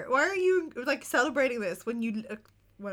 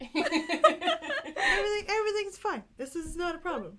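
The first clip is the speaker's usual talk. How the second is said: sarcastic fine; 7 semitones higher than usual. Pretend.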